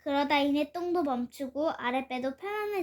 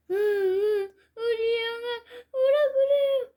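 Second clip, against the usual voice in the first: high, loud wailing through tears; crying child; 9 semitones higher, 7 dB louder, slower than usual. A narrator's reading. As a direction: beg through sobs. Child crying in toilet stall.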